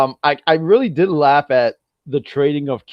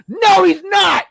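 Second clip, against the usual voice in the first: very high, indignant yell; high-pitched ranting rebuttal; exasperated shout; 4 dB louder, much slower than usual. Real, not acted. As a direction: shout it down in comic outrage. Ranting rebuttal to mocked fans.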